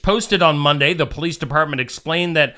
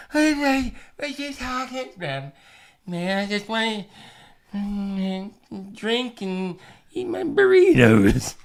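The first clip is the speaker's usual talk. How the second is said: put-on slurred, slow drunk man; 5 semitones higher, 5 dB quieter than usual. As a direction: play a sloppy drunk demanding burritos. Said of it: "That's my drunk voice".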